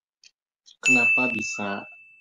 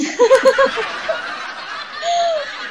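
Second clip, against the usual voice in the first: laughter, much higher; high burst of laughter; 8 dB louder than usual. Real, not acted. Laughing at joke.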